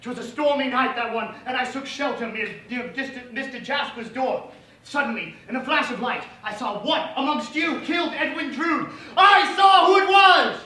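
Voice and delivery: theatrical storytelling swelling to a shout; melodramatic witness. Acted. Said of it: "a brief montage of every character".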